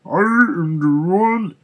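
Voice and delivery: full metallic villain retake. Acted. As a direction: boom it like a robot overlord. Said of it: "I was trying to do a metallic voice here".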